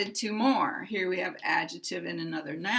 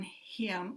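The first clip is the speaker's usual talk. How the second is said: him said in full with a clear h; stressed him; 10 dB quieter than usual. Shown as a demonstration. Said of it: "if we had stress on".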